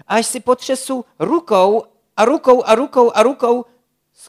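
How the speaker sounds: high, loud gushing list; excited chatter. A narrator's reading. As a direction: bubble over with beach plans. Šibalka gushing about beach plans.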